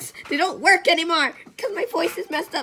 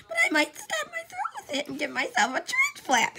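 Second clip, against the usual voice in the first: high squeaky voice; 14 semitones higher, faster than usual. Pretend.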